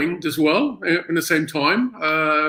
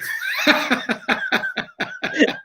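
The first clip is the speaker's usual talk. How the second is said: big laugh; 4 dB quieter than usual. Real, not acted. Laughing at his own Cockney.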